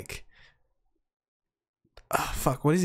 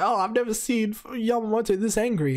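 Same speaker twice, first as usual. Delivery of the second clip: higher-pitched mock astonished quote; lightly voiced lieutenant; 9 semitones higher than usual. Retelling the lieutenant's reaction to Yamamoto, lightly astonished. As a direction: lift the pitch to imitate an amazed lieutenant. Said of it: "the lieutenant's like".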